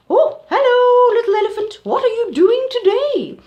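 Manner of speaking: very high, loud cheery greeting; Auntie Hippo voice. Acted. Auntie Hippo greeting the little elephant, very high and loud. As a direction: gush hello like a jolly aunt.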